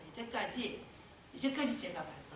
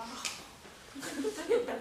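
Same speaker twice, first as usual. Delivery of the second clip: laughing; 5 semitones higher, 5 dB louder than usual. Retold or imitated. Quoting her own refusal.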